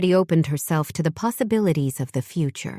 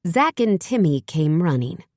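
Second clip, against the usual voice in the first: plain reading voice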